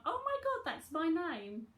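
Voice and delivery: surprised gasp. Retold or imitated. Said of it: "gone and seen a memorial wall and gone".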